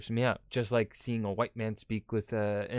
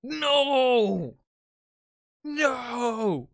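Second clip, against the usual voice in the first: high, loud, horrified "No!"; a put-on voice shrieking in disbelief; outraged shout; 12 semitones higher, 6 dB louder than usual. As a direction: cry out in mock horror at the news. Outraged character objects to left-side driving.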